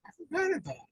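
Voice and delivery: lifted high and light, set apart from his own voice. Shown as a demonstration. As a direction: say it high up, almost as an aside. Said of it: "John said".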